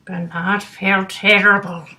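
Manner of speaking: plain reading voice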